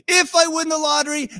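high-pitched conditional, held up; bargaining if. Acted. Believer bargaining with God, mock hopeful high pitch. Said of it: "And if then".

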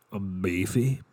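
"beefy" drawn out with a lift at the end; say-along demo. Shown as a demonstration. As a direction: model "beefy" slowly for others to repeat. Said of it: "Say it with me".

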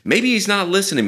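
high, sing-song mockery of the false prophets; mocking taunt. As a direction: needle them, teasing and high. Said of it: "kinda taunting them by saying".

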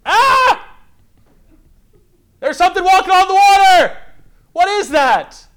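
loud, high-pitched panicked screaming; terrified yell. Acted. Disciples spotting something walking on the water, terrified yelling. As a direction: cry out in fright, pitch and volume way up. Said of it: "They yelled".

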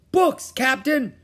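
high, slow, loud report; shouting down. A narrator's reading. Pinky to the captain from the treehouse, shouting down. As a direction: call down to your captain. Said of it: "Pinky shouted down".

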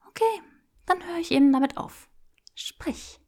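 high, breathy whisper; whispered command. Acted. Granger stopping and ordering Draco to talk, whispered.

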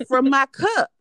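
hammed-up coworker from her own cup; coworker insisting. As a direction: push it like it's obvious. Coworker answer, retold scene.

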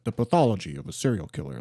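lightly voiced Ben Kingsley as Trevor. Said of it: "the Ben Kingsley one".

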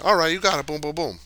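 high, loud, breezy clerk voice; hammed-up clerk casually taking a huge wager; mock clerk. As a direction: toss off the big bet like nothing. Imitating the Vietnamese clerk.

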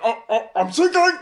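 mock panic, high and loud; panic mode. Overwhelmed cook mock-panicking at the fryer, high yelps. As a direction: squeal for help like you're drowning. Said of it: "full panic mode".

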